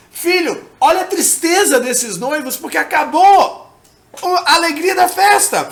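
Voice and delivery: acted motherly plea, building in volume; Mary appealing to her son. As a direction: point out the sorrow to your son, voice lifting. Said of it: "esses noivos terrenos falando".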